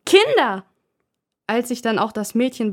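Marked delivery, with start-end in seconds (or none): 0.1-0.7 much higher, loud kinder with a falling end; louder bellow. Acted. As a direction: yell kinder at the top of your voice. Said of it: "brüllt die genervte Mutter".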